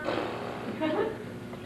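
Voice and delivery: airy unvoiced laugh, rising; soft airy chuckle; breathy laugh. Laughs after calling.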